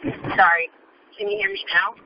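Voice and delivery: usual speaking voice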